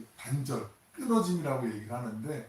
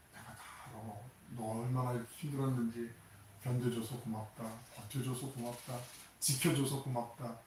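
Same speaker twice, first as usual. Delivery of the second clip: tender voice of Jesus; 8 dB quieter than usual. Acted. Jesus consoling Peter after denial, tender hush. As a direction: comfort him as if he were about to break. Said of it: "그 따스한 눈빛".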